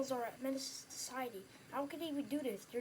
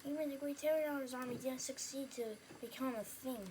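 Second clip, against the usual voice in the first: usual speaking voice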